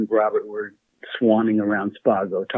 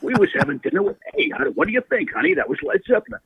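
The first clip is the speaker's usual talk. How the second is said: high, fast mock Don Rickles to his wife; hammed-up raspy comic impression, pitched way up; imitating Don Rickles; 13 semitones higher, faster than usual. Rickles telling his wife who they just saw, in a high comic voice. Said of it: "and he's going".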